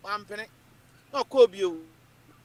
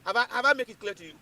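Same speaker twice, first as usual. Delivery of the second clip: ordinary talk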